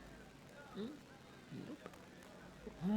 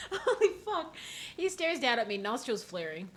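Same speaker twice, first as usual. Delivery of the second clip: laughing shock; 9 semitones higher, 6 dB louder than usual. Real, not acted. Shocked by a huge man.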